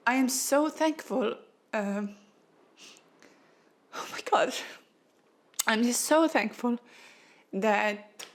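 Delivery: choked-up thanks, voice catching; fighting back tears as she speaks; emotional, near tears. Real, not acted. To her viewers, overcome by a client's story, close to tears. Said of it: "it makes me very emotional".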